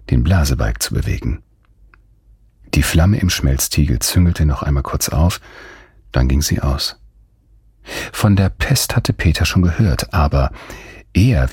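ordinary delivery

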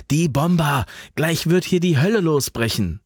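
high, urgent warning. A narrator's reading. Man warning Alva of the coming bombers, urgent. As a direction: warn her in alarm.

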